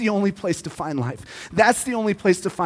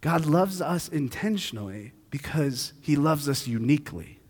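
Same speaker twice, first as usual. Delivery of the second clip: softer, lower ending; quiet close; 4 semitones lower, 6 dB quieter than usual. Acted. Pastor closing the sermon softly. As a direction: land it gently.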